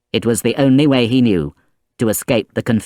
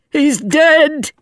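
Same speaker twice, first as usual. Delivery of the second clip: put-on high, anguished old man's cry; terrible cry; 4 dB louder than usual. A narrator's reading. Old grandfather seeing his grandson, crying out in horror. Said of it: "cried the old man in a terrible voice".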